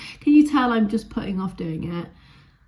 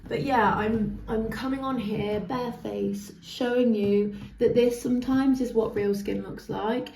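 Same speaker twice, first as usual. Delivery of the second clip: ordinary talk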